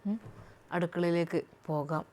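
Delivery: husband speaks gently to his new wife; husband's voice. Acted. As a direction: speak as the soft-spoken husband.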